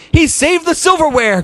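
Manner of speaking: mother praising the dog, excited; cried out. A narrator's reading. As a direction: exclaim with joy like a proud mom. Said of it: "cried Mother".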